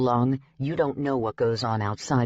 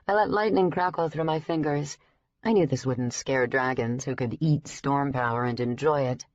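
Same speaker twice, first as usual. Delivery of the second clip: plain reading voice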